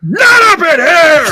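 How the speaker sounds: loud, high, drawn-out bellow; slow, high mock-furious shout; imitated angry shout. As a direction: explode at a heckler, stretching every word. Imitates angry player shouting back.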